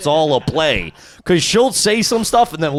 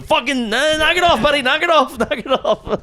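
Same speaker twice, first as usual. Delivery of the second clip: much higher mock scolding, laughing at the end; mock irritated friend, higher; imitating Ryan; 10 semitones higher than usual. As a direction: play the exasperated friend telling him to quit. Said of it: "Ryan's going".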